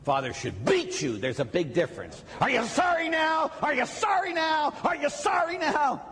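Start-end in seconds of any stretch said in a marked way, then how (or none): 2.4-6.0 high-pitched shouted demand, drawn hard on SORRY; mock yelling priest. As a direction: play a priest berating a penitent. Priest mock-beating a penitent.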